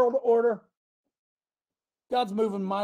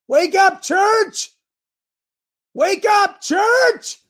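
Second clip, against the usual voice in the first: shouting; 13 semitones higher, 9 dB louder, much slower than usual. Real, not acted. Preacher calling out to church.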